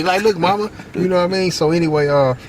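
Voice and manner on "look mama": put-on babyish boast. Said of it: "I was a baby".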